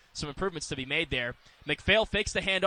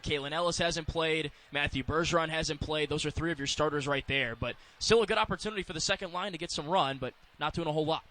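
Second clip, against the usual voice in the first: ordinary talk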